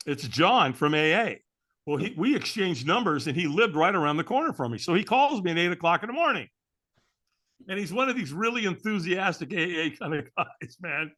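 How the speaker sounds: ordinary talk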